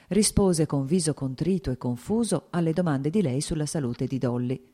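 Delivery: plain reading voice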